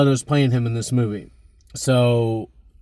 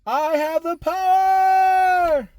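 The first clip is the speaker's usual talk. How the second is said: heroic shout; much slower than usual. Acted. Completes He-Man catchphrase.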